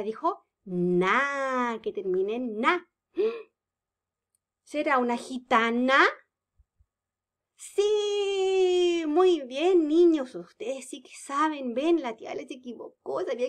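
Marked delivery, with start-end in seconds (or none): 3.1-10.3 gasp, then high, bright praise; mock delight. Teacher pretending to discover, then praising the children brightly. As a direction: act out happy surprise.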